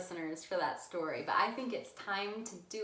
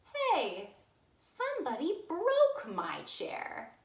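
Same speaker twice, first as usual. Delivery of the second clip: much higher, louder little cub voice; squeaky Baby Bear; 10 semitones higher, 4 dB louder, slower than usual. Acted. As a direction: squeak it like an upset tiny bear.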